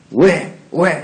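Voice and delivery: acted indignant questioning; demanding why. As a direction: fire each question upward like an accusation. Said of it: "하고 따지는 거예요".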